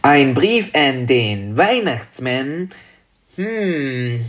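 slow, drawn-out dragon voice; grübelnder kleiner Drache. Acted. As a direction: ponder slowly as a little dragon. Dragon ponders the letter question. (langsame, grübelnde Drachenstimme)

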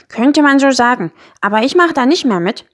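lightly voiced grumpy creature digging in; lightly voiced Miesmuffel, defiant.

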